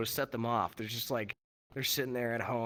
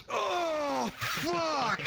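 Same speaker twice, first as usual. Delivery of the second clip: high startled yelp of disgust; mock disgusted shock; 3 dB louder than usual. [name] recoiling when his buddy shoves smelly feet in his face, startled yelp. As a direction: jolt back from the stink.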